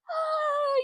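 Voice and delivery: high falsetto mock scream; brief squealed "ah" standing in for a scream; imitated scream. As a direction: imitate a scream, very high but held back. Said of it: "you know, she's screaming".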